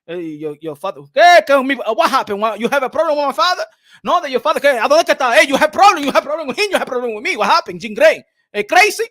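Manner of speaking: squaring up, playfully furious; loud put-on tough-guy challenge; mock-angry confrontation. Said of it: "I do the Canelo Alvarez to Jim Gray".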